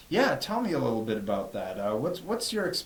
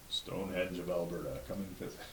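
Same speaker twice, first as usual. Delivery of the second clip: deep, soft announcer voice; low radio announcer read; 6 semitones lower, 12 dB quieter than usual. Shown as a demonstration. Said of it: "That's a very good radio voice".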